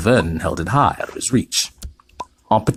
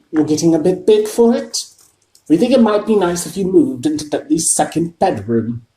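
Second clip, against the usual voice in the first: higher, strained politeness; forced friendliness; 9 semitones higher, 4 dB louder than usual. A narrator's reading. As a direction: uncle fakes niceness. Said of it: "forced his face into a smile".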